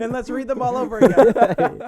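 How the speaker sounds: laughing. Real, not acted. Joking about rereading comments.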